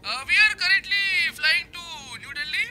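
slow, drawn-out captain PA voice; pilot announcement. Pretend. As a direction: speak like a pilot on the intercom. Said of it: "अपने को announcement करने का है".

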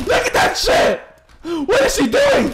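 very high, squealed disbelief; alarmed shouting. Real, not acted. Alarmed reaction to enemy in game.

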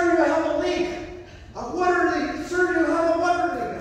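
ordinary delivery

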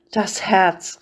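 a lightly scornful echo of Herz; sneering repeat of the word; mocking. A narrator's reading. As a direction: throw Herz back with mild mockery. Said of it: "rief er höhnisch".

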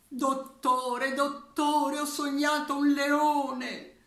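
high, loud urgent plea; anxious patient. Acted. Patient begging the psychiatrist about a dream, high and loud. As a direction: call out to the doctor in a fluster.

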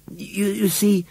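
high, faltering start; miserable. A narrator's reading. Susan trying to explain to Emily, miserable and halting. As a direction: stammer it out miserably. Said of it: "began Susan miserably".

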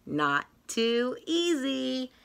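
ordinary delivery

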